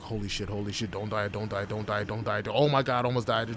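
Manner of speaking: mock panicked chant under an outer calm; acted-out anxious inner voice. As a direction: gabble it like a frantic silent prayer. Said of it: "inside I was like".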